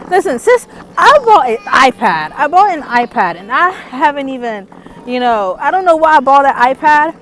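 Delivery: loud, high excited gush. Real, not acted. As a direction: gush about it, laughing. Excited confession about iPad.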